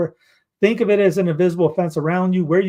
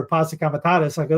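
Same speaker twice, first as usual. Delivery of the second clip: ordinary talk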